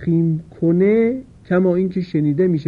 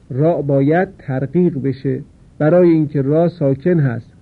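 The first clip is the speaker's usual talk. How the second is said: ordinary delivery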